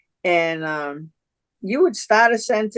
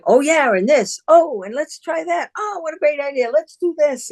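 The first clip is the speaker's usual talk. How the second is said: much higher, eager overlapping-ideas voice; excited brainstorming; 9 semitones higher than usual. Retold or imitated. Guest re-enacts everybody putting in ideas, excited. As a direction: imitate a room bubbling with ideas.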